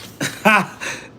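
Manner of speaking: caught-off-guard laugh; burst of laughter. Real, not acted. Streamer reacting to Brittany's opening, surprised laugh. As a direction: burst out laughing at what you just heard.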